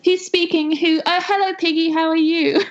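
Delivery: put-on high girlish phone voice. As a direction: greet Piggy sweetly, way up high. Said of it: "she said".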